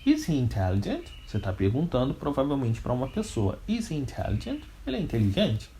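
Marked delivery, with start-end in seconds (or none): 0.0-1.0 "intelligent" rising at the end; yes-no question tune, up on the end of "intelligent"; question intonation. Shown as a demonstration. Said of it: "precisa mudar a nossa entonação". 3.6-4.6 "intelligent" lifted into a question; soft question with a final rise on "intelligent"; question intonation. Shown as a demonstration. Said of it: "precisa mudar a nossa entonação".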